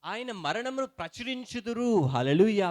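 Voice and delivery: fervent preaching. Acted. Pastor rousing the congregation about communion, fervent preaching.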